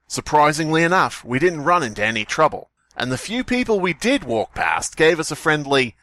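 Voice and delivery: ordinary talk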